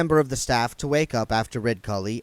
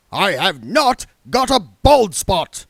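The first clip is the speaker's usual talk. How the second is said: slow, loud, indignant denial; 8 semitones higher, 9 dB louder, slower than usual. Acted. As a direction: bellow the denial. Indignant Arch-Chancellor denial.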